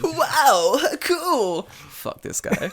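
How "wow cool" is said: high, loud mock-impressed dude; exaggerated dated cool-guy wow; nineties dude. Mocking an out-of-touch expat, hammed-up dude voice. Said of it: "still speaks English that way".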